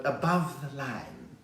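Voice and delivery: put-on slow, stretched southern suburbs Cape Town accent. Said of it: "those people who talk like that".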